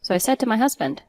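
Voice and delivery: plain reading voice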